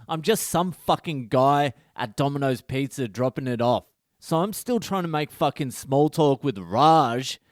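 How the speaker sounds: normal talking voice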